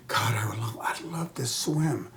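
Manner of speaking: breathy whisper, slow and hushed; whispered thought. Retold or imitated. Wishing to swim in the reservoir, whispered. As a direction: whisper the longing to yourself. Inner thought while watching water.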